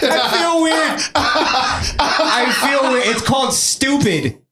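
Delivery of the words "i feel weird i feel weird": giggling through the line; slow laughing repeat; laughing mock cop.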